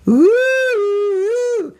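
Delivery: high, silly falsetto whoop; Three Stooges whoop. Retold or imitated. Stooges fan shaking hands, silly high whoop. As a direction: whoop like a slapstick comedian. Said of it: "sometimes he would go".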